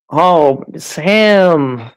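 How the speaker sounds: usual speaking voice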